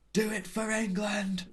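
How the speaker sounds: high, drawn-out mock battle cry; weird Little Britain voice. Friends quoting the film's final fight scene, high mock battle cry. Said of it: "weird little Britain voice".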